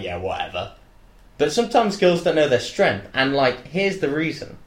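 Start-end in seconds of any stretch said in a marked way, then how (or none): 0.0-0.7 retold dismissal. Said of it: "she was like".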